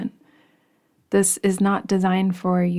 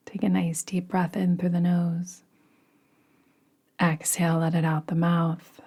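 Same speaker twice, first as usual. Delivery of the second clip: guided meditation. Acted. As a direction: ease them gently into rest. Leads a yoga nidra meditation.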